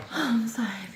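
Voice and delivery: soft breathy whisper of real happiness; whispered joy. Real, not acted. Showing off her new orchid spike, a hushed overjoyed whisper. Shows plant, overwhelmed joy.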